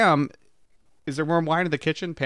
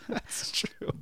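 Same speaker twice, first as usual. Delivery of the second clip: whispered; 10 dB quieter than usual. Real, not acted. Whispered third repeat.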